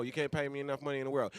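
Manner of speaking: normal talking voice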